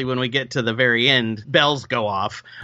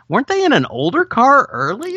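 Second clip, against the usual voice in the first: high-pitched retold puzzlement; puzzled realisation; 9 semitones higher than usual. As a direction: wonder aloud, voice jumping high. Retells own puzzled reaction.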